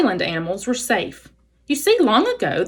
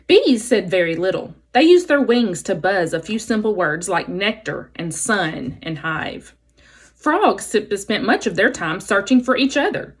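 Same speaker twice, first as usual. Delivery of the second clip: plain reading voice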